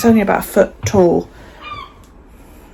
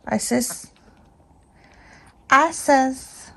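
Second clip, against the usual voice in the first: ordinary talk, nothing marked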